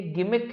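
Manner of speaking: "गिमिक" with a hard g; correct pronunciation. Shown as a demonstration. Teacher correcting students' pronunciation, "गिमिक" with a hard g. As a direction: say "गिमिक" starting with a g as in "go". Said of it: "pronunciation क-, सही करें".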